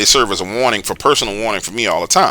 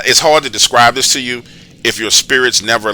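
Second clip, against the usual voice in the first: usual speaking voice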